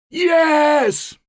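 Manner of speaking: very high, loud crowd yell; bellowing. A narrator's reading. Ten thousand answering their leader, bellowed shout. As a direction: roar back as one. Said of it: "bellowed ten thousand".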